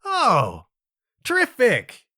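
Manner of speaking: high, mock-delighted terrific; sarcastic. Real, not acted. A swimmer eyeing the crowd, dryly sarcastic. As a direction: sell the sarcasm with a lift. Sarcastic about joining the group.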